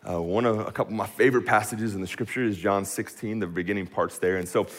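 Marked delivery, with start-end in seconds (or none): none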